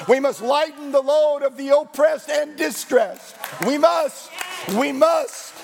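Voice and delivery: mounting preacher's call to action; preaching with fervour. Real, not acted. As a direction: drive the refrain home to the congregation. Preaching at full force.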